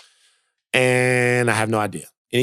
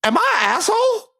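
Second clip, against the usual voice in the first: much higher, pleading question; mock plea. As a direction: squeak it out like a guilty kid. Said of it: "asks the segment title".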